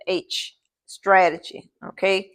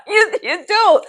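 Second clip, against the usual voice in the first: laughing; 6 semitones higher, slower than usual. Real, not acted. Teacher laughs at falling asleep.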